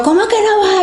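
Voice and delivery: shrill high outraged cry of disbelief; loud frantic "no way" to the aliens; hysterical protest. Retold or imitated. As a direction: retell the panic at full pitch. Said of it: "Yo estaba histérica".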